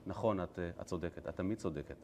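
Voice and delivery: low, soft placating murmur; meek surrender. Acted. Balaam placating his angry donkey, meek surrender. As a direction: concede softly, voice dropped.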